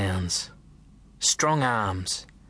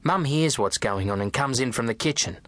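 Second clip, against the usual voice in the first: ordinary delivery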